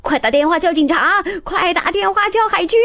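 high, loud panicked orders; panicked shouting. A narrator's reading. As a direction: call for help in a panic. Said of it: "教练尖叫起来".

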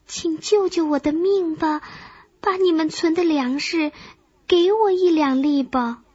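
weak and feeble. A narrator's reading. Starving grasshopper begs an ant for grain, weakly. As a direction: beg as if drained of strength. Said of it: "他有气无力地说".

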